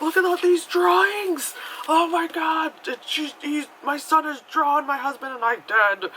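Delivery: high, loud frantic mom voice; mock panicked mom. Mom discovers her son's drawings, mock panic. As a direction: play her alarm hammed-up and high-pitched.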